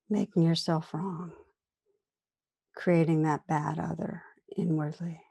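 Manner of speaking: calm meditation guide, long pauses between phrases; guided meditation. Real, not acted. Teacher inviting listeners to turn attention inward, guided meditation. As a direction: gently lead listeners inward, unhurried, leaving room after each phrase.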